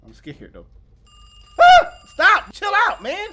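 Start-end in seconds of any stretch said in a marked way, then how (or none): 1.6-3.3 high scream then loud, pitched-up pleading; real fright in the voice; startled yell. Real, not acted. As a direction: shout down the scare. Another jump scare.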